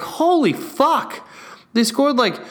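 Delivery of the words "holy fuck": higher, slow disbelief; shocked exclaim; stunned. Retold or imitated. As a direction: gasp at the score. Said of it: "I'm like".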